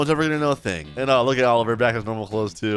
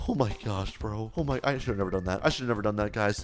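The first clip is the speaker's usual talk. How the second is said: softer, dropping into regret; guilty quiet; 4 semitones lower, 7 dB quieter than usual. Real, not acted. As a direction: mumble it like the guilt just hit. Realizes he robbed the doctor.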